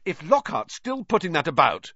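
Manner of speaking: put-on angry boy starting a tirade; hotly. A narrator's reading. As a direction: protest heatedly. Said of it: "he said hotly".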